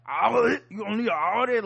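mocking bad impression. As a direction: mimic a ridiculous put-on voice. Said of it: "no one talks like that".

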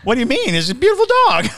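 doting. Acted. Doting on the dog creature.

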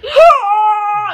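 long, high, loud panicked cry; crazy yell. Retold or imitated. Student in front of the guard, crying out loud. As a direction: let out a wild stoned scream. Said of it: "I just start making this crazy sound".

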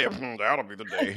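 slow, unimpressed sass; sassy drawl. Pretend. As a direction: shrug off the threat, slowly. Liz's sassy reply voiced.

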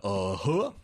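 goofy Southern uh-huh. Retold or imitated. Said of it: "His accent sounds like every sentence should be".

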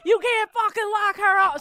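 high, loud furious scream. Retold or imitated. Friend kicking the car, screaming at the arresting cop. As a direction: scream at the cop to let her go. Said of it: "Scream, right?".

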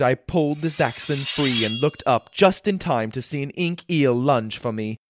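plain reading voice

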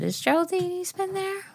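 put-on, high-pitched official phone voice; embassy lady caller. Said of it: "it's a lady".

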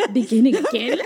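laughing through the words; laughing as she answers. Real, not acted. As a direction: giggle it out.

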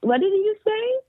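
high, innocent, straight-faced question; deadpan feigned innocence. Retold or imitated. Adult asking the toddler to repeat it, keeping a straight face. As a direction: ask it perfectly innocent, hiding a laugh. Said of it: "had to be completely frozen".

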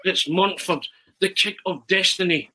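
high, excited football commentator urging himself on; Arthur Montford commentary. Acted. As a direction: commentate a dramatic penalty run-up, pitched high. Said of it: "So I start commentating on myself".

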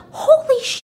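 very high-pitched mock gasp of disbelief; retold shock. Anna finds the photo of herself sleeping, high shocked squeal. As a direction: squeal it out as an acted freak-out.

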